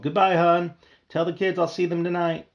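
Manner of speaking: father calling out. A narrator's reading. Father calling goodbye to the family as he leaves. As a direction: call it back over the shoulder.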